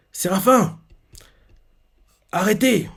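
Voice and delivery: retold yell to the seraphim; mock shout. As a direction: shout at them to stop. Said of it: "Il a crié ça".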